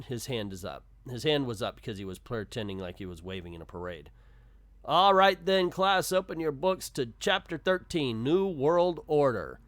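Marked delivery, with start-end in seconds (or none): 4.9-9.6 loud, higher-pitched classroom voice; teacher calling out. Acted. Teacher telling the class to open books, loud announcing voice. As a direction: address the class, slowing on the last words.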